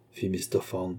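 ordinary delivery

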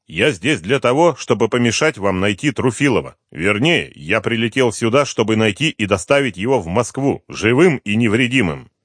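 lightly voiced firm detective; Дронго's firm voice. A narrator's reading. Detective tells the fugitive his aim, firm. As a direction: lay out the mission plainly.

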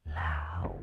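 eerie, very soft possessed hiss; hissed word by word. Acted. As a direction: spit out each word like a ghost. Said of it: "rít lên từng chữ". (rít lên từng chữ, ma quái)

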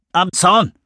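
Finn over radio. A narrator's reading. Finn answering Jones over the walkie-talkie, crisp. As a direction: report back short and crisp.

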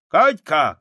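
put-on loud, high shout to a child; calling out. A narrator's reading. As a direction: call the girl over sharply by name. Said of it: "крикнул он".

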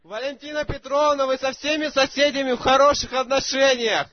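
high, loud shout into the phone. Real, not acted. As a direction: bellow the question at full volume. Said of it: "говори, чтоб тебя слышно было".

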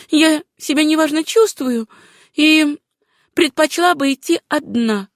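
high-pitched woman's character voice; heroine declining company. Acted. Susanna declines the doctor's company.

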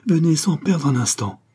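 high, pressing plea; urgent. A narrator's reading. Young man urging the count not to delay, urgently. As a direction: hurry them on eagerly. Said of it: "dit vivement le jeune homme".

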